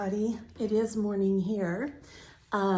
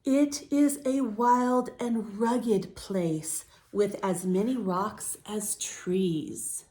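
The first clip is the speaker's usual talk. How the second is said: slow, gentle read-aloud; story narration; slower than usual. A narrator's reading. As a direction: read it calmly to young listeners. Reads picture book to children.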